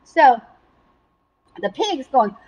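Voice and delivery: ordinary delivery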